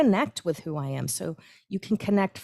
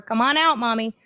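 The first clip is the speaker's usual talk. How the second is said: put-on higher, louder child's plea; child calling mommy; 4 semitones higher, 4 dB louder than usual. Kids banging on the door call mommy, mock childlike. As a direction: call through the door like a kid.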